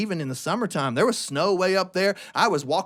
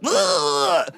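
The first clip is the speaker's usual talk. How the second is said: high, pushed grunt; mock grunt; grunting glare; 11 semitones higher than usual. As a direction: make an ugly-face grunt. Making the ugly face at duck.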